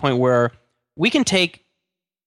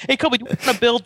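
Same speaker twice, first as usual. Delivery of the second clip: put-on coaxing the kid; 10 semitones higher, much faster than usual. Imagined dad coaxing son.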